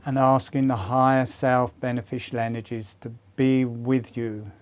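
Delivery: gentle fading meditation guide; guided meditation. Acted. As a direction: guide them into calm with long pauses. Leads a meditation.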